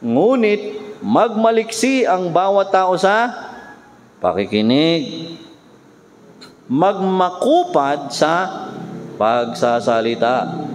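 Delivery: slow, solemn reading of the verse; scripture reading. Acted. Pastor reads the Bible verse to the congregation, slow and solemn. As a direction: read the verse slowly and gravely. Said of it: "sabi ng Bible".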